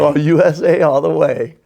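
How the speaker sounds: mock-patriotic cheer. Gambler echoes the host's patriotic quip, mock-patriotic.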